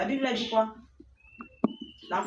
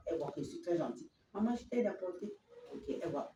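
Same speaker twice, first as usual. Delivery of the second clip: aparté chuchoté; 3 semitones lower, 8 dB quieter, faster than usual. Acted. Enfant pense en aparté.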